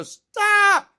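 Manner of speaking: sudden high, loud shout; retold yell. Agent yelling at the client lighting the gas fireplace, loud and high. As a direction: yell it to stop him.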